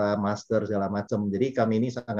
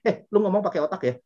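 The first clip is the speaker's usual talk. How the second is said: a stranger snaps back at the speaker, mock angry and raised; mock angry retort; 8 semitones higher than usual. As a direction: hurl it back like an offended stranger. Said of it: "akhirnya ngomong sama saya".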